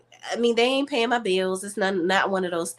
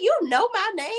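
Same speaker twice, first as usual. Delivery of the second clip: amazed question; 12 semitones higher than usual. Retold or imitated. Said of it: "I said".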